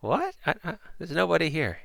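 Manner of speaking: high, stammering disbelief; baffled prisoner hearing a voice from nowhere; bewildered. Acted. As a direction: stammer in high-pitched confusion. Prisoner baffled by invisible speaker.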